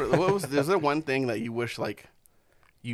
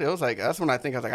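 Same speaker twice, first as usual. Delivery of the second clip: normal talking voice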